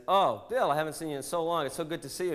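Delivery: high, chirpy greeting voice; mock friendly greeting. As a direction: gush hello to an old friend. Friend greeting in told joke.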